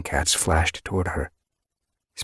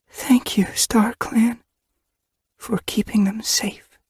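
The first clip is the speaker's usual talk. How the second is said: higher, hushed whisper of thanks; whispered prayer; slower than usual. A narrator's reading. Mother thanks StarClan over her sleeping kits, whispering. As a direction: breathe the prayer so the kits don't wake.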